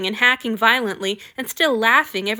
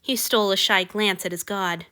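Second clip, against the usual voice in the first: usual speaking voice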